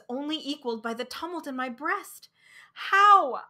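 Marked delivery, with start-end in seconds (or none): 2.7-3.4 high, loud desperate how; crying out. Acted. Man at the sea crying out in despair, high and loud. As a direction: fling the question out loud and high. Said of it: "I cried".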